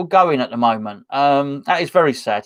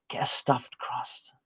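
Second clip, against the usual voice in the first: breathy whispered aside, slow; hushed slow whisper of a pizza tip; 12 dB quieter, slower than usual. Real, not acted.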